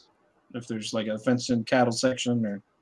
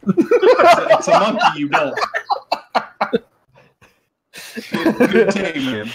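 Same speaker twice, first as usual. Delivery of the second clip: loud, high exasperated snap; exasperated retort; 7 semitones higher, 9 dB louder than usual. Acted. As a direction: brush her off and go. Investigator snaps then leaves.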